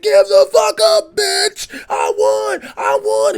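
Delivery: high, loud, slow shrieking yell; hammed-up high-pitched monster scream; Godzilla screaming victory. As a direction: shriek it over a beaten foe. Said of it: "he's like".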